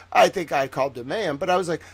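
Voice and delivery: usual speaking voice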